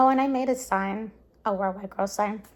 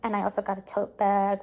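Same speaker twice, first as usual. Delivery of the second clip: ordinary talk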